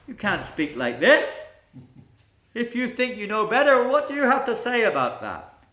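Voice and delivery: loud, high, haughty rebuke; indignant pandit. Acted. Proud court pandit scolds the newcomer, loud and haughty. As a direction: put the upstart in his place.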